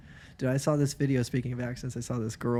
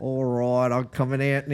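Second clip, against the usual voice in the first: broad mock Australian, a bit louder; Australian accent; 5 dB louder than usual. Playing along after being told off, mock Australian accent. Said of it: "I'm Australian".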